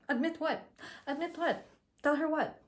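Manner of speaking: high-pitched playing innocent; mock denial. Second girl told to confess to Mina, high-pitched feigned cluelessness. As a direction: imitate her challenging it, pitched well up. Other girl denies being found out.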